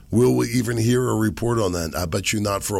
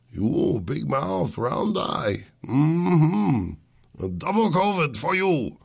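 put-on drawn-out, caricatured Chinese accent, rising higher; mock Chinese accent; 5 semitones higher, much slower than usual. Imagined delivery man to the sick host, mock Chinese accent. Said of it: "it was a Chinese dude".

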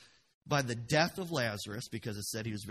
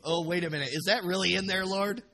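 raised, louder questioning of the Lord; mock disbelief; 6 semitones higher, 7 dB louder, faster than usual. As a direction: question it with playful astonishment. Acted disbelief at the verse, first take.

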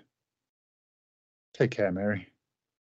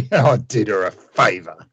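cruel chuckle then a cold reply; mock the accusation with contempt; the villain laughs off trampling a child; sneering laugh.

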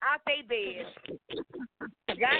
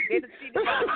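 louder repeat; 8 semitones higher, 4 dB louder, faster than usual. Real, not acted.

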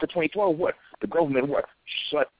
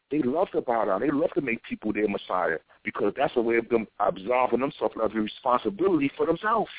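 ordinary delivery

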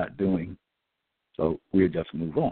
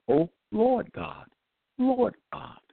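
preaching invocation; 13 semitones higher, slower than usual. Real, not acted. Preaching scripture, high and slow.

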